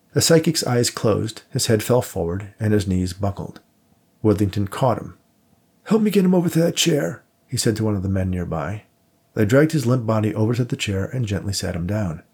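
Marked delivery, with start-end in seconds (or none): 5.8-7.2 urgent plea. A narrator's reading. Urgent call for help.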